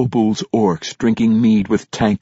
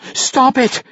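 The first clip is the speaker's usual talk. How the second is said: high, sharp cry to stop; demanding. A narrator's reading. Dwarf widow crying at Brunner to stop smashing things. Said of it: "Yuene demanded".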